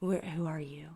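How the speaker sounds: imitating a soft, low monotone. Neighbor questions someone unseen in the room, monotone. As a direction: ask it low with little inflection. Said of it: "his voice was all of a sudden monotone".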